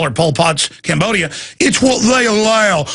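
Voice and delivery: usual speaking voice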